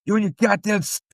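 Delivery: strained, high mock outrage; mock outraged impression. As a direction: mimic the outburst in a high put-on voice. Co-host mimics the angry line.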